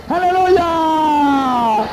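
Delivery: long, drawn-out high shout of praise; hallelujah shout. Real, not acted. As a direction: cry it out slowly to the rafters. Preacher cries out in praise.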